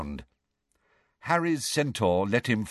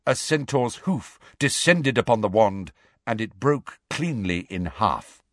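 usual speaking voice

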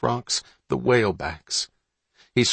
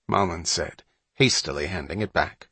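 usual speaking voice

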